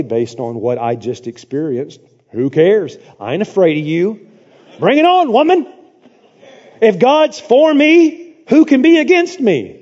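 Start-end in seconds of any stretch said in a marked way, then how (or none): 2.3-9.8 defiant bravado. Retold or imitated. Preacher answering Jezebel's threat, defiant bravado. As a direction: throw down a cocky challenge. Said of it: "I would say".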